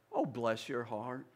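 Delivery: slow, syrupy Southern "Oh, bless your heart" with a rising "Oh". Retold or imitated. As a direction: drawl it out like a sweet Southern lady hiding a jab. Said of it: "In the South, they say".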